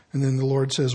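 ordinary talk, nothing marked

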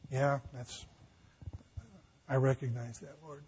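soft, hushed reply to God; Paul quietly admitting it to the Lord; humble Paul. Acted. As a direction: answer meekly, almost under your breath.

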